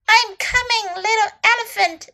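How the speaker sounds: high-pitched storybook call; crying out. A narrator's reading. Snake rushing to rescue little elephant, crying out. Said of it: "Snake cried".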